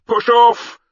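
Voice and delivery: high, loud shouted order; ringing boatman's yell; called out. Acted. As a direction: shout it across the water to the crew. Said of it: "A voice called".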